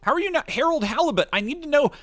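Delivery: high, loud disbelief; incredulous. Real, not acted. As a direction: demand it in disbelief. Incredulous outburst at game label.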